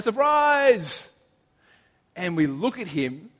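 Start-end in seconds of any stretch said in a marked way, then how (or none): 0.0-1.1 higher, louder mock group yell; retold yell. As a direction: shout it like jumping out at him. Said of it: "We all jump out and yell".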